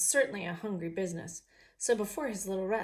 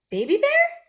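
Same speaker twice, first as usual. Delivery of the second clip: much higher, much louder astonished call; surprised; 14 dB louder than usual. Acted. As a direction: cry out in joyful recognition. Mommy recognizes bear.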